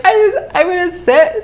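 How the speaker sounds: high, mock tearful voice; imitating talking through sobs; can't stop crying. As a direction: squeak it out as if crying. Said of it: "I can't stop crying".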